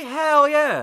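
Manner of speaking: high, loud retold cheer; gleeful cheer. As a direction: whoop it in approval. Retold delighted reaction to the scene.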